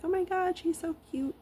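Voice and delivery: squealing high, gushing delight; gushing over cute dog. Retold or imitated. Passersby gushing at the dog in her jacket, high and cooing. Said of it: "and people are like".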